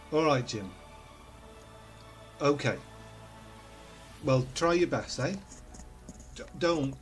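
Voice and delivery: normal talking voice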